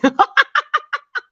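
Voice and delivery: high staccato cackle; laughing. Real, not acted. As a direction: burst into high, rapid laughter. Laughing at a viewer's comment.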